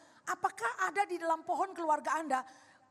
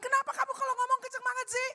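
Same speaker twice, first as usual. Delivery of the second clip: high-pitched exasperated question; mock complaint; 9 semitones higher than usual. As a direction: protest in a raised, put-upon voice. Acts out complaint about loud talk. (protes dengan nada tinggi)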